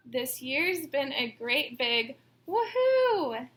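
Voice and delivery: slow, loud joyful woohoo; excited cheer. Acted. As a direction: cheer it out big. Cheer line of class poem.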